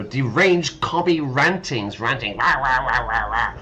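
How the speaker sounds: loud mock rant; hammed-up ranting. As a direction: play a raving ranter, pushing it out. Hams up the ranting option.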